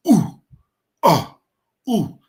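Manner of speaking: put-on loud, high baby coos from the chest; baby cooing sounds. Shown as a demonstration. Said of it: "just like a baby".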